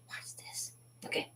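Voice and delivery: breathy whispered tease. Real, not acted. Whispering to viewers before the sparkle reveal. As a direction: lean in and whisper it.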